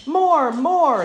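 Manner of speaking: loud, high delighted call. A narrator's reading. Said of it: "called out".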